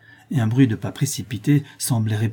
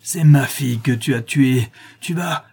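louder, forceful outburst breaking off; furious accusation; 3 semitones higher, 5 dB louder than usual. Acted. Prince confronts his daughter's killer, loud furious accusation. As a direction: accuse him with mounting fury.

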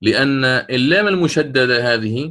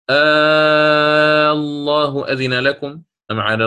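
slow, drawn-out chanted recitation of the verse; Quran recitation; 2 semitones higher, slower than usual. Shown as a demonstration. Teacher recites the example verse to students, slow tajweed chant. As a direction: recite it with the long madd stretched out. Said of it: "ويدخل معه أيضًا".

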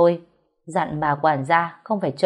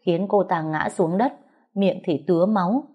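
plain reading voice

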